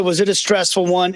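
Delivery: ordinary talk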